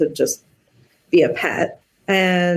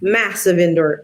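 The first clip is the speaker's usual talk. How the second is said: ordinary delivery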